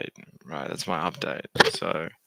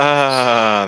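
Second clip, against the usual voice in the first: loud, long, higher "aah"; relieved "ah"; 5 semitones higher, 13 dB louder than usual. Real, not acted. Streamer finding the sound works, relieved ah. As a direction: let out a big drawn-out ah of discovery.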